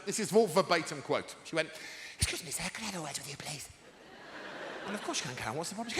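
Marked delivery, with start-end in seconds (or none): 2.2-3.7 soft, fast and very polite; a hint of a fussy little housewife; prim housewife pupil. Pupil asking her teacher for a word, prim and soft. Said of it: "like a repressed nineteen forties housewife".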